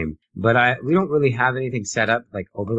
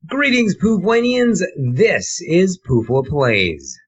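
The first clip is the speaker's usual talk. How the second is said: show host announcer; 8 semitones higher, much slower than usual. Acted. Host opens the live show.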